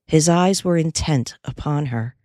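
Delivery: ordinary delivery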